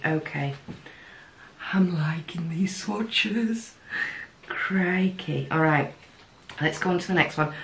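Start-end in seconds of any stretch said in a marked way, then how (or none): 1.7-3.7 pleased whisper. Real, not acted. Pleased with the swatches.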